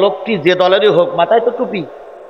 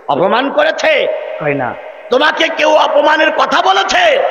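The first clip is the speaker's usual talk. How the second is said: worried son asking; 10 semitones higher than usual. Acted. Son questioning mother.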